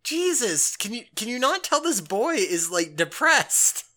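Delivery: high, incredulous outcry; can't believe nobody sees it; exasperated disbelief. Retold or imitated. The speaker reacting to the oblivious teacher, exasperated and high. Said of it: "And I'm like".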